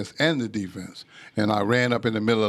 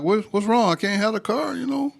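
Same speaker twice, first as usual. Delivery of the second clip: put-on much higher, worried squeal of a teen; high panicked whine; 12 semitones higher than usual. Teen Ricky fearing he loses the car, high panicked whine. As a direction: squeak it out like a kid losing his car.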